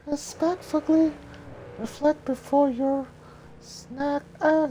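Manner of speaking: put-on very high, breathy young heroine; high girl voice. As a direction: lift into a high girlish falsetto. Mulan's voice.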